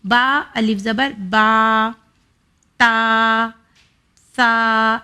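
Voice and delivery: slow, each aa held for two beats; two-finger stretch. Shown as a demonstration. Teacher timing the stretch by fingers, long aa. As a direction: draw the vowel out while counting two fingers. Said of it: "اتنا sound آنا چاہیے".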